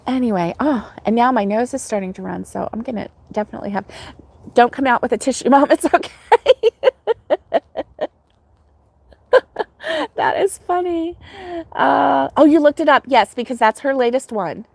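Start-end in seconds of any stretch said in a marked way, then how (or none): 5.5-12.3 laughing. Real, not acted. Laughing reply to chat.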